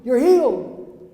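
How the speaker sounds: high, loud healer's proclamation; revival-preacher yell. Retold or imitated. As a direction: shout it at passers-by. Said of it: "I yell at people".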